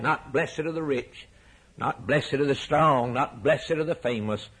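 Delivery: usual speaking voice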